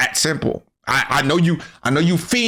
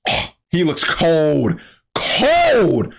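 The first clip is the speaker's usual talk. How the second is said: hyped drawn-out praise; awed hype; 2 semitones higher, much slower than usual. Real, not acted. Admiring Trunks' look.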